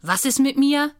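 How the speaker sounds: höher und lauter, herausfordernd; drohend. A narrator's reading. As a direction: eine drohende Frage des Bösen. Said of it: "geht drohend einen Schritt auf sie zu". (drohend, höher und lauter)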